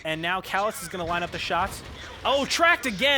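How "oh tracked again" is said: sudden high excited cry; excited shout. Real, not acted. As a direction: yelp it out in disbelief. Shock as the tank is tracked.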